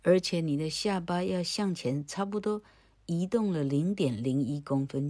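ordinary delivery

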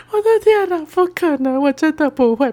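high panicked oh my god; panicked disbelief. Real, not acted. 被老师突然要求操作机器，惊慌地高声喊. Panics about unfamiliar machine task.

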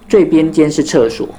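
usual speaking voice

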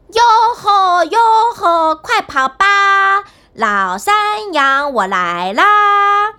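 高声拖长地欢呼, 慢; 骑着山羊放声吆喝唱着; 欢快吆喝. A narrator's reading. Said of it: "同时唱起歌来". (汉斯骑羊赶路时高声慢慢吆喝)

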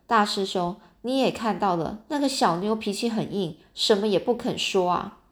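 ordinary delivery, nothing marked

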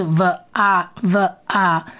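V啊 said as va with short a; sound demo. Shown as a demonstration. Teacher blends V and a for listeners, sound demo. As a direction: blend v and a clearly. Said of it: "所以这里是".

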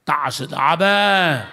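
slow, loud call for a big Amen; preacher demanding a loud Amen from the congregation, slow and loud. Real, not acted. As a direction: stretch it out and push the congregation to answer.

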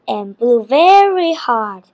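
usual speaking voice

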